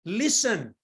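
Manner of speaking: high-pitched mock yell without the volume; half-voiced call for attention; mild mock yell. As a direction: imitate yelling at everybody, held back. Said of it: "I'm trying to yell at everybody".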